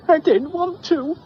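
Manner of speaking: high, loud sulky whine; girl refusing her mother; whiny child. Acted. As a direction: sulk it like a child.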